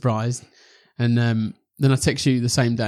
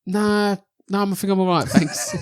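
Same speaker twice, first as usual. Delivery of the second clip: awkward refusal; 8 semitones higher than usual. Retold or imitated.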